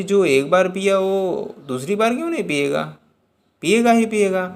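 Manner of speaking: high and loud, firmly certain; fervent insistence. Acted. Meera assuring her grandfather, high and insistent. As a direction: she is sure he'll drink it again. Girl insists the Lord will drink.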